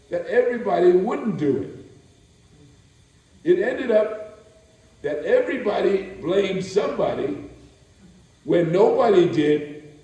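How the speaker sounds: a spoken-word poem swelling to full voice; building recitation. Acted. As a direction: start measured, then climb into an insistent, emphatic peak.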